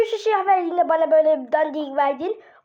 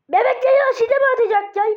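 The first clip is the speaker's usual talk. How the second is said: high, loud, fast put-on baby voice; changed voice; 8 semitones higher, 5 dB louder, faster than usual. Baby character asking his brother, high put-on voice. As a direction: squeak it out like an excited baby character. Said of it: "Oğlum senin sesin değişti sanki".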